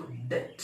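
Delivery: डेट with silent b; correct pronunciation. Shown as a demonstration. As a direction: say "det" with no b. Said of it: "बी शब्द नहीं है". (डेट, "ब" बिना बोले)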